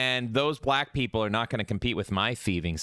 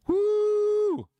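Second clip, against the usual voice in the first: excited cheer; 4 dB louder than usual. Real, not acted. Cheering about Grok-chan.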